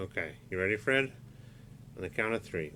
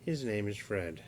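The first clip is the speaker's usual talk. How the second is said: ordinary delivery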